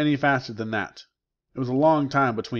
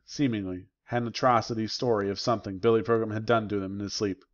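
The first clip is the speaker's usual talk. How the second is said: usual speaking voice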